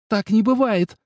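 high mousy squeak. A narrator's reading. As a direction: squeak it out like a startled mouse. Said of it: "пискнула не как кошка, а как мышка".

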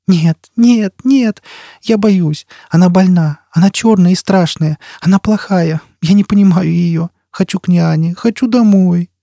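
put-on high, panicky child's voice; small voice pleading in fear, pitched high; frightened child crying out. A narrator's reading. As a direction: cry out like a terrified little child. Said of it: "Дитя вскрикивало и тряслось".

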